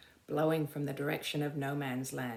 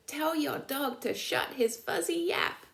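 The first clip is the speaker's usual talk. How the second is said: higher whiny complaint; moaning soldier; 10 semitones higher than usual. Acted. A soldier moans at Conroy about his noisy dog. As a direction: moan it like a sleepy grouch. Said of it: "another soldier moaned".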